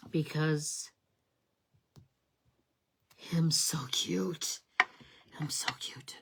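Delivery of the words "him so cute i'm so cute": cute whisper. Pretend. Cooing over stamped dog.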